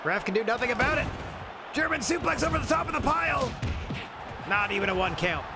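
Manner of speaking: excited, high play-by-play call; wrestling commentator. Acted. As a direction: call the action like live ringside commentary.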